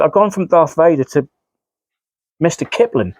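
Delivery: ordinary delivery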